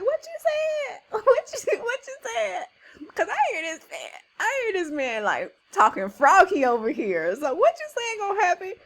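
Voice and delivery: high, loud, incredulous retort; playful high-pitched challenge, firing back questions; David's mock indignation. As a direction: act out a cocky, affronted young man. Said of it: "He's like".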